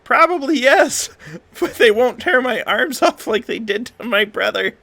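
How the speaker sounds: a happy hat counting tips; oblivious bartender. Acted. Sebastian the hat bartender cheerfully pleased about tips.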